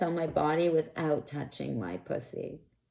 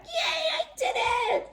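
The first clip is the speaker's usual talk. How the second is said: much higher, laughing cheer of triumph; excited cheer. Retold or imitated. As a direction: squeal it out like you just pulled it off. Retold own triumphant reply.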